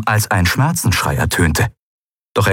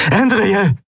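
calling her name; 13 semitones higher than usual. A narrator's reading. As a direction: shout her name in alarm. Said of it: "hörte sie Kai rufen".

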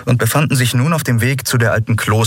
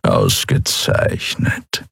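very low, slow, drawn-out purr; 11 semitones lower, much slower than usual. A narrator's reading. Chasu hears the auction is hours away, purring. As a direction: purr it out with deep contentment. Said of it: "schnurrte Chasu".

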